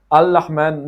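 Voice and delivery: 'Al-Rahman' loud, with an L for the first R. Shown as a demonstration. Teacher recapping mistakes, L replacing R. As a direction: press a long L in 'Al-Rahman'. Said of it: "it is not Al-Rahman".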